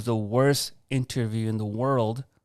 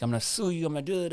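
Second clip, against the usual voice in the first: mildly worked-up retold threat, pitched high; emotional; 7 semitones higher, faster than usual. Employee threatening his boss, emotional and high-pitched. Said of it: "I was emotional".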